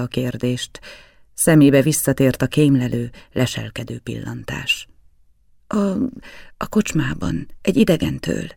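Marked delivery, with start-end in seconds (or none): 5.7-8.5 a touch of hoarseness, haltingly voiced; halting answer with a slight rasp; hoarse. A narrator's reading. Startled boy answers the man who caught him reading, hoarse. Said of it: "mondta rekedten".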